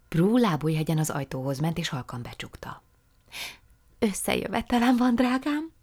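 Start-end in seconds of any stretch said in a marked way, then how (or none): 4.0-5.7 put-on higher, lively young woman; Prue's eager voice. A narrator's reading. Prue apologising to her aunt, high and eager. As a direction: apologise sweetly and brightly.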